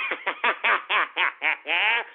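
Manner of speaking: long staccato cackle; drawn-out gloating laugh; mocking cackle. Real, not acted. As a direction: laugh derisively in rapid even bursts. Laughs at listeners he just insulted.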